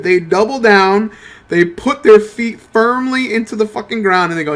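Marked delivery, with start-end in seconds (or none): none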